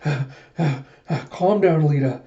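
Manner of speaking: panting, then self-soothing; gasp, then steady yourself; lightly acted breathless nerves; mild fluster. Alita swooning, calming herself.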